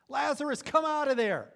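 loud, high shout to the tomb; shouted call. Retold or imitated. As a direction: call him out at full voice. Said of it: "he screams at the top of his lungs".

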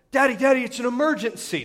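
mock-frantic little kid voice; imitating excited child. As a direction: play a kid urgently calling for Dad. Child's urgent cry, retold.

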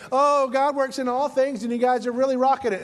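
upbeat Colossians voice. Retold or imitated. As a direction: breeze through it like an eager cheerleader. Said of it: "And Colossians is like".

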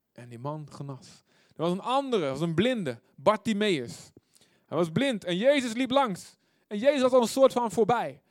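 ordinary delivery

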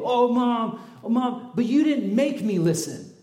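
high, loud mock whine; hammed-up child excuse; whiny kid. Kid whining back at mom.

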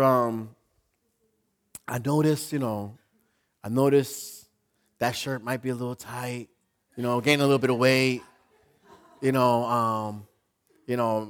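soft, low, hesitant tactful advice; said with love. Acted. Church member gently raising the pastor's weight, said with love. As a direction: break it gently, with careful pauses. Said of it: "that was said with love".

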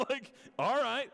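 high, loud startled reaction; a pastor's stunned okay; surprised. Retold or imitated. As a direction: caught completely off guard.

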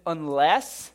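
UNLESS thrown back loud, as a rising question; appalled, questioning UNLESS; incredulous echo. Acted. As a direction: query the word UNLESS in disbelief. Echoes the verse in disbelief.

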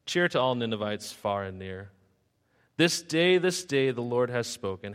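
lively, sing-song storybook reading in Seuss rhythm; rhyming story narration. A narrator's reading. Preacher reading a rhyming Jonah tale to the church, sing-song storytelling. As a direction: tell it like a bedtime rhyme to the congregation.

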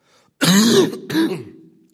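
loud harsh coughs; a real loud coughing fit. Real, not acted. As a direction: cough hard into the mic. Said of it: "Please forgive my coughing".

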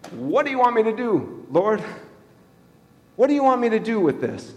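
a frustrated leader throwing up his hands; exasperated Moses. Retold or imitated. Moses exasperated asking God.

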